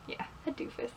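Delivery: a private little aside, barely voiced; whispered aside. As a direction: whisper it to yourself, amused.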